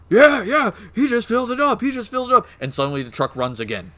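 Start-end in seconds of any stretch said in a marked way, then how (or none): 0.1-2.5 high, eager orc-mob voice; orc crowd agreeing. Acted. Orc crowd backing up the boss, high and eager. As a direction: squeal agreement like dim-witted grunts. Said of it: "all the orcs go".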